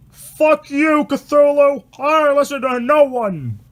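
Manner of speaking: high, loud defiant yell; desperate shout. Acted. Captain rams the monster, screaming desperate defiance. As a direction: bellow it in the monster's face. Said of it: "with a desperate shout".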